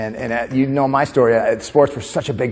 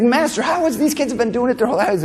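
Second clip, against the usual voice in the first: put-on puzzled kid; 10 semitones higher than usual. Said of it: "He's like".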